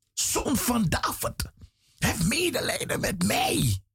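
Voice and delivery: high-pitched pleading call; imitated crying out. Blind man calls out to Jesus for mercy, crying out. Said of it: "hij gilde en hij roept".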